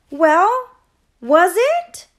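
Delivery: high, loud, rising question; insistent demand. A narrator's reading. Dog rushing to the tree for the ending, loudly insistent. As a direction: demand the answer, pushing each word up.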